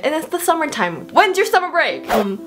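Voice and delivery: ordinary talk, nothing marked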